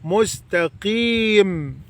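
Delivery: مستقيم said very slowly with a full kasra on the قي; slow drilled word. Shown as a demonstration. Teacher drilling مستقيم slowly for the child. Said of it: "نعلمه يقفل بقه عليها".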